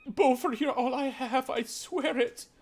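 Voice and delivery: high, teary laughing plea; desperate mother. Acted. Mother swearing to her son he's all she has. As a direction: clutch at him with a nervous laugh. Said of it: "And she goes".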